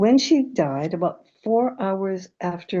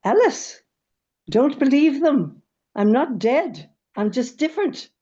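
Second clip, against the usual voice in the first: put-on Irish accent; 7 semitones higher, 4 dB louder than usual. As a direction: retell Nana's words in a high Irish lilt, higher pitched. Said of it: "she said in her Irish accent".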